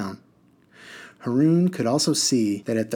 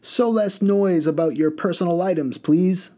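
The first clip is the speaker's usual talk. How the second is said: curt rebuke; 8 semitones higher, 4 dB louder than usual. Acted. Man rebukes woman.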